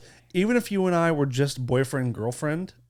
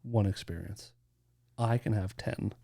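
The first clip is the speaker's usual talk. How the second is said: very soft, low, slow near-whisper; low, hushed, deliberate; hushed deal-making; 6 semitones lower, 11 dB quieter, slower than usual. Pretend. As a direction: lay down the terms quietly. Joke proposal in hushed voice.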